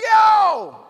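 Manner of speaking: high, loud drawn-out yelp; a big pained holler; sudden yell. Acted. Acted outcry, foot nearly run over.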